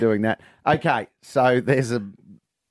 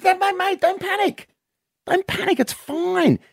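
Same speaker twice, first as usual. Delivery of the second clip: much higher, reassuring stranger at the bar; high bloke voice. Retold or imitated. Stranger at the pub calming the drunk, very high voice. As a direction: squeak it out like a helpful pub stranger.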